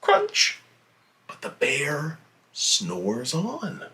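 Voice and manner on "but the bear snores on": hushed refrain, don't wake the bear; soft breathy whisper for the sleeping bear; whispered storybook refrain. A narrator's reading.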